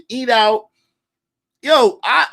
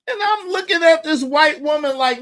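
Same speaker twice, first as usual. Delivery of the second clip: ordinary delivery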